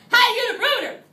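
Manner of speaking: high, loud call-out voice; weird voice. Shown as a demonstration. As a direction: shout it in a silly high voice. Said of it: "We say it in a weird voice".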